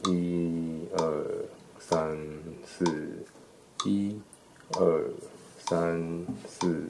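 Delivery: hushed count along with the metronome; soft steady beat count; counting beats softly. Shown as a demonstration. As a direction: count the beats under your breath. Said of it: "搭配节拍器来算一下".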